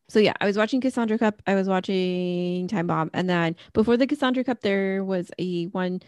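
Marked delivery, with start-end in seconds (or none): none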